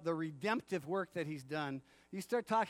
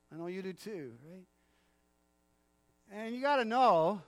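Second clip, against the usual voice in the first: ordinary talk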